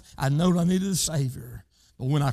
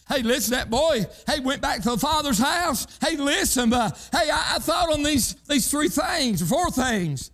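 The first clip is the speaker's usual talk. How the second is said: preaching; 8 semitones higher than usual. Acted. Preacher fervor.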